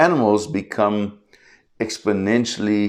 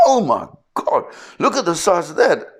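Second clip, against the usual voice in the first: much higher, gushing with awe at the size; mock tourist amazement, pitched way up; amazed tourists; 11 semitones higher than usual. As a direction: gasp like a first-timer seeing a shark. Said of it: "everybody's like".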